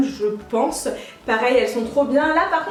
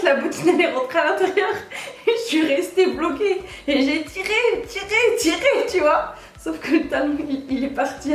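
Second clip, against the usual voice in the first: higher-pitched, laughing through the story; laughing retelling; 4 semitones higher than usual. Real, not acted. Vlogger telling viewers her boot got stuck in a grate, laughing retelling. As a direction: tell the mishap while giggling, rising on each tug.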